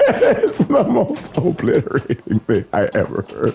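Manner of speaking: slow, high words through laughter; laughing hard. Real, not acted. Reacting to the hilarious obliteration, laughing hard. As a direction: draw it out while laughing. Still laughing at own joke.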